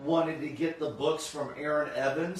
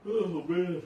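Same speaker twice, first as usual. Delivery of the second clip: mid-yawn words; 4 semitones higher than usual. Real, not acted. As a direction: say it through a yawn. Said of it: "I'm yawning".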